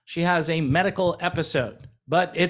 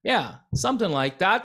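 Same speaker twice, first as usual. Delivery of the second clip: ordinary talk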